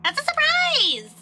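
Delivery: very high, drawn-out tease; gleeful high-pitched coyness; teasing singsong. Acted. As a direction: sing it out like a secret. [name] teases about breakfast.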